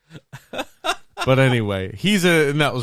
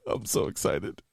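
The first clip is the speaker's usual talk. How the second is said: hushed, much softer confession; excited whisper; 8 semitones lower, 8 dB quieter than usual. Real, not acted. Breathless aside after squealed reaction.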